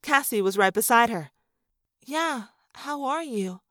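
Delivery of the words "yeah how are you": soft, slow, careful question; carefully neutral. A narrator's reading. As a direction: ask softly, keeping it carefully neutral. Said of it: "in a carefully neutral voice".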